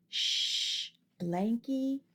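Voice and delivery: usual speaking voice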